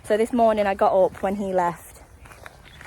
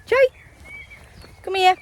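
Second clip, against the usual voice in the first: calling the dog; 13 semitones higher, 5 dB louder than usual. Real, not acted. Calling dog to come back.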